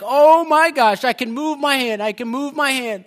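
high, loud exclamations of disbelief; joyful amazement. Retold or imitated. Preacher voicing a healed man discovering his hand moves, overjoyed. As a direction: stretch the opening, then burst out with joy. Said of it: "he's just filled with joy".

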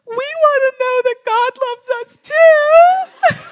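put-on high, loud, drawn-out girlish plea; mock tearful wail. Girls wanting God's love too, hammed-up crying shout. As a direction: wail it out, imitating overcome girls.

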